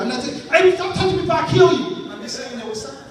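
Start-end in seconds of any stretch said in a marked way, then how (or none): 0.5-2.1 loud, high snapping yell; sudden yelled threat; mock angry yell. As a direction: bark it out like you mean to hurt them. Acted outburst at toucher.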